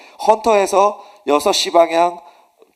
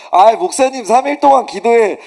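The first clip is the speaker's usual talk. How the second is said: insistent protest; 7 semitones higher, 9 dB louder than usual. Retold or imitated. Junior pastor insisting to his senior pastor that he will preach, loud protest. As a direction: insist on taking the burden.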